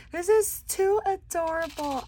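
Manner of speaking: high, thrilled coo; high gush. Real, not acted. Crafter showing a shaker card, high gush. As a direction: gush over how adorable it is.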